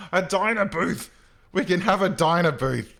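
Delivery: loud, high-pitched delighted exclamation; thrilled, bursting into laughter; excited outburst. Real, not acted. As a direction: shout it with sheer glee at a brilliant find. Delighted at finding diner booths.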